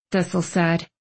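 ordinary talk

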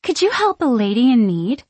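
thrilled coquette asking for help; barely masked excitement. A narrator's reading. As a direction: play the damsel with eager excitement. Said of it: "with barely masked excitement".

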